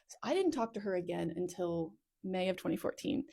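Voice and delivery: ordinary talk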